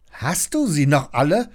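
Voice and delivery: scolding. A narrator's reading. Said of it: "schimpft Lilli".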